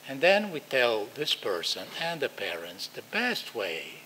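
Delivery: ordinary delivery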